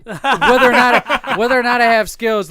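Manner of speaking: laughing. Real, not acted. Laughing at the skills jab.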